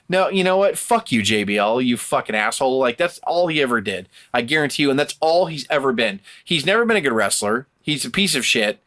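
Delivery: ordinary talk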